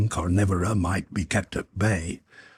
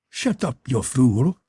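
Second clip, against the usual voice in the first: growled; 4 semitones higher than usual. A narrator's reading. Brady silencing Sinclair, understated growl. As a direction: snap it out, lightly irritated. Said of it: "growled Brady".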